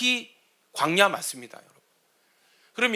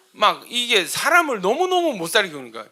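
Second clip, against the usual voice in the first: ordinary talk, nothing marked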